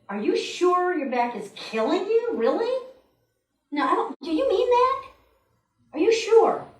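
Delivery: high, mock-doubting questions; incredulous questioning. Subconscious imagined questioning your complaint, mock-incredulous. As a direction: press them in exaggerated disbelief.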